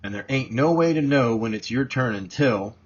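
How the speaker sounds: usual speaking voice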